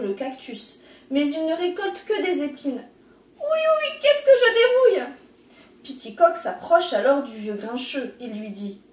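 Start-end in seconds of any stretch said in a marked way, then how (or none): 3.4-5.1 high, loud ouch cry; yelping in pain. A narrator's reading. Snake pricked by the cactus, high loud yelp. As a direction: yelp it, stung by thorns.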